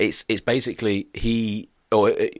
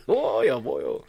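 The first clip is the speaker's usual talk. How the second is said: put-on echo of the Welsh accent; 3 semitones higher, slower than usual. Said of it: "when he just rocked up".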